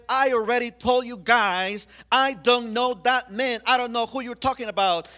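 a cornered man shouting off his accusers; loud, exasperated denial; angry denial. Acted. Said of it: "He started cussing out everybody".

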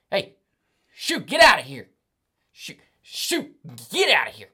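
loud high shout; shouting shoo. Shown as a demonstration. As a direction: shoo them off the roof. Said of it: "you shout up at the".

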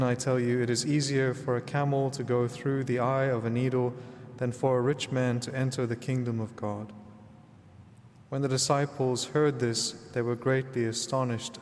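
ordinary talk

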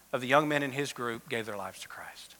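whispered reveal, barely voiced; hushed whisper. Real, not acted. Pastor to congregation, revealing the campers' conversions in a whisper. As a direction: drop to a whisper for the payoff. Dramatic reveal of camp outcome.

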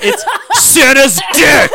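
loud, slow laughing yell; laughing shout. Real, not acted. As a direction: blurt it out laughing.

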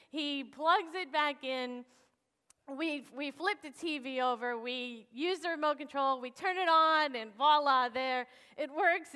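ordinary talk

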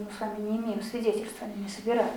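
ordinary delivery

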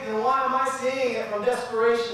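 high, loud, rising preacher's cry; preaching at full force. Real, not acted. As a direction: build the sermon to a passionate peak. Preacher builds to a climax.

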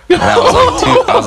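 high squeal of laughter; high-pitched laughing outburst. Real, not acted. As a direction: laugh through the words. Laughing disbelief retelling.

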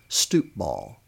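plain reading voice

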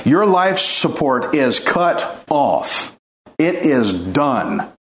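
slow and low, dropping to a hush; grave slow pronouncement; slow, solemn. Acted. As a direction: let it land heavy and final. Solemn ending of judgment.

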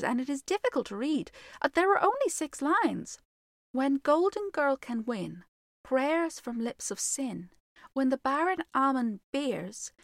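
a hint of an earnest little girl; young girl voice. Virginia recites the prophecy.

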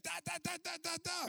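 high-pitched imitated volley; rapid-fire "da-da-da". Preacher imitating the man laying into her, rapid-fire volley. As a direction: rattle off nonsense syllables like an attack.

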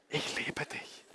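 soft voiceless confession of love; hushed, intimate whisper; loving whisper. Shown as a demonstration. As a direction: whisper it close and tender. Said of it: "dann flüstere ich".

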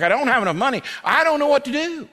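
high-pitched exasperated plea; overwhelmed congregant. Acted. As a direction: plead as someone at wit's end, pitch climbing high.

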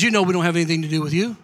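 dismissive Jesus. Acted. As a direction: rush the refusal under your breath.

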